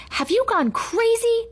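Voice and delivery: high, drawn-out incredulous shout. A narrator's reading. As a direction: shout it in disbelief, stretching the words. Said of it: "shouted Allison".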